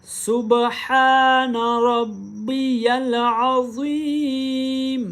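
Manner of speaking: slow सुबहान with a distinct h; सुबहान रब्बियल अज़ीम, h clear and slow. Acted. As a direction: recite सुबहान, h clearly heard. Recites the tasbih.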